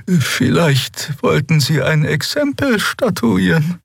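slow, high, effortful voice; weakened, halting figure. Acted. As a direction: labour through the words, high and slow. Weakened Xea speaks with effort. (hohe, langsame, mühsame Stimme)

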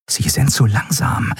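whispered realization; hushed realization. A narrator's reading. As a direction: breathe it as it dawns. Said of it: "erkannte Endriel".

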